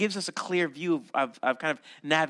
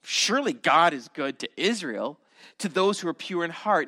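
sarcastic. Shown as a demonstration. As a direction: read the verse flippantly, as if you don't believe it. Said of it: "what you have to say to the inflection is".

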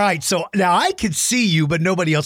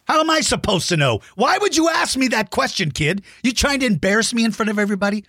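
higher, fast, hammed-up offended; playful fake outrage; mock indignation; 6 semitones higher than usual. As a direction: pretend to be insulted by a kid. Mock offended at math question.

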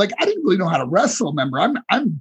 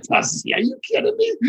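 incredulous protest. Retold or imitated. Incredulous reply to Dusty, retold.

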